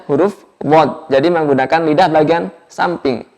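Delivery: usual speaking voice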